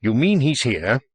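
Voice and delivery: usual speaking voice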